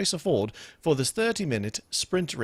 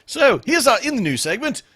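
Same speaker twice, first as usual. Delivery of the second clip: segment intro in a goofy put-on Scottish accent, louder and higher; stupid Scottish accent; 11 semitones higher, 9 dB louder than usual. Host introducing the news segment in a silly Scottish accent. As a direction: announce it with a silly Scottish lilt. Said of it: "if I say it in a stupid accent".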